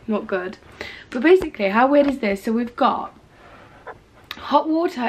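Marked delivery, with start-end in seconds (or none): none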